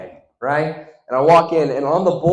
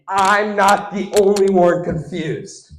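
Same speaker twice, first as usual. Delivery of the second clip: slow, raised-pitch announcement; drawn-out emphasis; 4 semitones higher, 4 dB louder, slower than usual. Shown as a demonstration. Comic telling the crowd what he noticed, slow and emphatic. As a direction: stretch the words out slowly. Repeats the line two ways.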